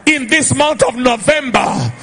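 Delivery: loud, high-pitched prophetic declaration; full-force preaching. Real, not acted. Preacher prophesying to the congregation, full-force declaration. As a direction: proclaim it over a crowd, ringing and emphatic.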